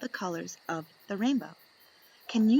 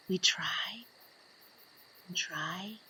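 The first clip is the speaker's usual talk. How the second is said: hushed whisper of careful watching; breathy whisper in the woods; 6 dB quieter, slower than usual. A narrator's reading. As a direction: whisper so the bird won't hear. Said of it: "We pay attention".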